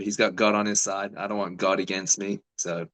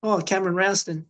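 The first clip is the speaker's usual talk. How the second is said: much higher-pitched voice calling a name; imitated surprised greeting; 10 semitones higher than usual. Fighter retelling his opponent's greeting across the hotel hallway, high imitated voice. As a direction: mimic someone recognising you across the hall. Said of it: "this voice goes".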